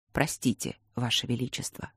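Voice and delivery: soft, defeated apology; barely above a breath, sorry; quiet apology. Acted. Sorcerer apologizes, failed cure.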